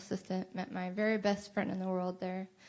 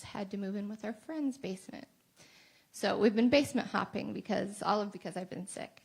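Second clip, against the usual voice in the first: normal talking voice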